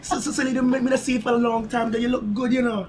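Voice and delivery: high, loud Jamaican accent with laughter. Shown as a demonstration. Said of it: "I do the Jamaican accent".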